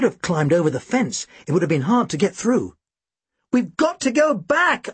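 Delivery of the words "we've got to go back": high, urgent, drawn-out shout. A narrator's reading. Narrator to Tim on finding the bridge closed, shouted. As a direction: shout it urgently, stretching the words. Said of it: "I shouted".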